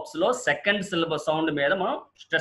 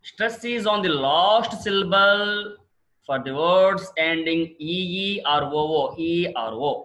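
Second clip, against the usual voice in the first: very slow, stretched rule with LAST drawn out; slow rule reading; much slower than usual. Shown as a demonstration. Teacher reads the next rule to class, very slow. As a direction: read it out deliberately, lengthening key words. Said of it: "next rule అండి".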